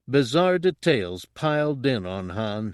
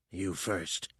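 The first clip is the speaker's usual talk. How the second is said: softer, almost breathed out; 2 semitones lower, 9 dB quieter than usual. A narrator's reading. Fett to Han at gunpoint, very softly. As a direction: say it so softly it barely sounds like him. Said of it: "in a voice so soft".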